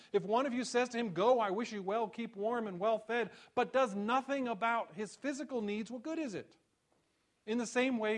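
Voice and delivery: high and loud, pressing challenge; preaching James's words. Acted. Pastor voicing James to the congregation, preaching at full force. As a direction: demand an answer from the pews.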